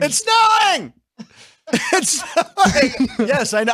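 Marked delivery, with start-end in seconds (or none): 0.0-0.9 high-pitched delighted shout, a mock announcer call; excited yell. Host mimics the broadcaster's snowy call, excited yell. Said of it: "You can't hear him yell". 1.9-3.3 stretched slow yell breaking into laughter; laughing through the mock call; laughing imitation. Host repeats the snow call while cracking up, laughing. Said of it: "You can't hear him yell".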